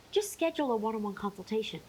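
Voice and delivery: ordinary talk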